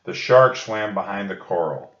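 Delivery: usual speaking voice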